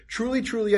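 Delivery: "truly, truly" lifted high and pressed; stress on truly. Shown as a demonstration. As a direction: press each "truly" firmly. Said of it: "huge emphasis here".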